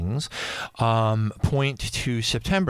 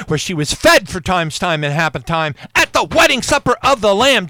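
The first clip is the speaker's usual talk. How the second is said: building higher and louder to a climax; rising excitement; 6 semitones higher, 6 dB louder than usual. Retold or imitated. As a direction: pile it on as the revelation hits.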